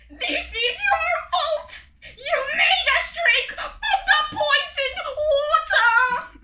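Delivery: loud, high, slow screech; furious shrieking monster bird; screeching accusation. Acted. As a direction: screech the blame at the leader. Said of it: "screeched one of the other birds".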